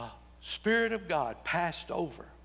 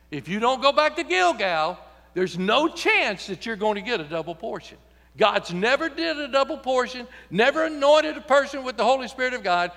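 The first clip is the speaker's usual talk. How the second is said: preaching at full force; 7 semitones higher, 5 dB louder than usual. Acted.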